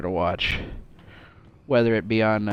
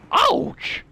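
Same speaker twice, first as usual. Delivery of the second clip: high yelp; 5 dB louder than usual. Real, not acted. Reacting in game.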